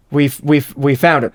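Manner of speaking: usual speaking voice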